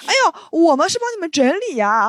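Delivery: lightly mock a nosy old neighbour; imitating old auntie. Neighbour auntie caught taking boxes, mock sweet voice. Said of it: "然后她说".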